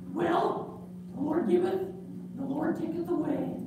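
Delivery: high, soft pious acceptance; a boy making peace with it; high puppet boy quietly reciting scripture; resigned puppet kid. Acted. Puppet Gus accepts loss.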